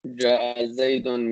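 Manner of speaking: Ja'a Zaydun said slowly, word by word; slow repeat. Shown as a demonstration. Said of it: "when we say".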